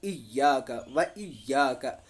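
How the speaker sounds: "इया" with a pressed, doubled y; firm, tense "इया"; hard iya. Shown as a demonstration. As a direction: shadda on the y. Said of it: "थोड़ी सख्ती करनी है इसमें".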